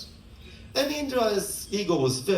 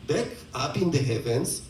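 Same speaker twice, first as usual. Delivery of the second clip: ordinary talk, nothing marked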